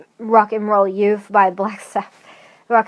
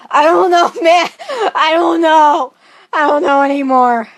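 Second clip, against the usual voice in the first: raised, louder fake meltdown; mock despair; 5 semitones higher, 5 dB louder than usual. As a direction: cry out helplessly as a joke. Mock despair, then says just kidding.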